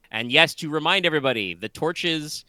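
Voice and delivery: high, quick emphatic game master voice; scene-setting GM. Acted. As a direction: set the scene with energy. GM emphatic recap.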